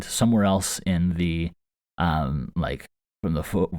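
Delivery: ordinary talk, nothing marked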